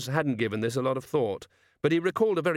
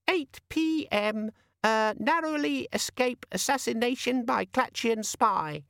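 very high, tinny little chirp; sing-song gadget voice; 13 semitones higher, slower than usual. Acted. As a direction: chirp like a tiny imp in a box. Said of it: "the little sing-song voice".

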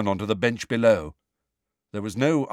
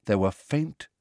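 plain reading voice

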